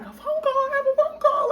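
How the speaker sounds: high, thrilled squeal; light up like it's the best news ever; excited. Retold or imitated. Hearing the intercom announce a call, squealing with excitement. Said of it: "I was so excited".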